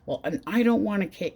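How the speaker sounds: ordinary talk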